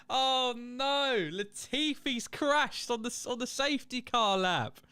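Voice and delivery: laughing through the words; giggling, can't believe it. Real, not acted. Streamer laughing at Latifi's crash, laughing.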